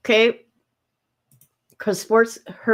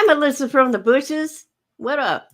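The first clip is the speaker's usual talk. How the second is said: ordinary talk, nothing marked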